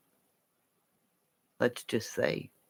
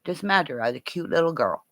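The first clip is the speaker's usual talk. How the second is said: usual speaking voice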